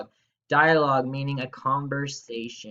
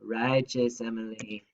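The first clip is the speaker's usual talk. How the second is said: normal talking voice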